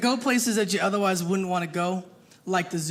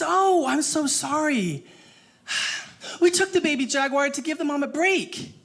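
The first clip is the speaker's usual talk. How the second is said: zookeeper lady; 4 semitones higher than usual. Acted. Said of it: "And she says".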